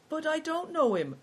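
high-pitched, plaintive objection; unhappy protest. A narrator's reading.

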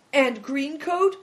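high, slow, loud dread; terrified question. Acted. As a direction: ask it in alarm, rising high.

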